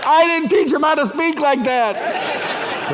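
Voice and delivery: high, drawn-out mock yell; hammed-up, high-pitched mother's protest; mom yelling out. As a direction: imitate an indignant mother calling across a room. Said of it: "my mom yells out".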